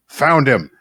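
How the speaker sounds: a hint of a grizzled old dwarf calling out. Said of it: "dwarf voice go".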